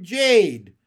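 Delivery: loud cry of the name; loud, desperate-sounding call; screamed. A narrator's reading. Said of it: "He kept screaming".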